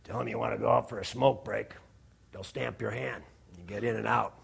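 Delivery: low, hushed gangster voice offering a tip; mafia guy. Retold or imitated. Guard tips preacher off, low hushed gangster voice. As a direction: lean in and tip him off quietly. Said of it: "Guido, some mafia guy".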